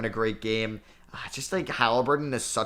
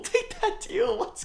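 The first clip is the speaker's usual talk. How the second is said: much higher, laughing squeak; giddy laughing plea; slower than usual. Real, not acted. Gamer urging Jordan Poole to sign, giddy laughing plea. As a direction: laugh and beg at once. Begging free agent to sign.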